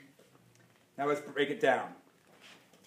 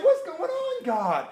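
much higher cry with a laughing sob; anguished outcry; 9 semitones higher, slower than usual. Acted. Believer crying out to God in despair. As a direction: throw the question up at God.

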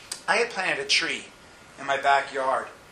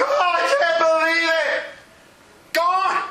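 hammed-up wail; 12 semitones higher, 4 dB louder, slower than usual. Mourner voiced, shouted.

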